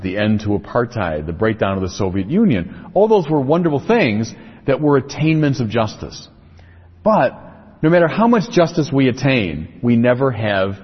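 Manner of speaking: ordinary talk, nothing marked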